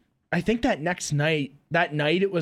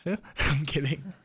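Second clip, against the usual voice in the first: laughing; 3 semitones lower, 6 dB quieter, much slower than usual. Real, not acted. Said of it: "Really fired up".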